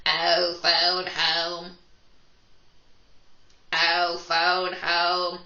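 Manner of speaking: slow, loud alien voice. Pretend. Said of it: "my alien voice for this one".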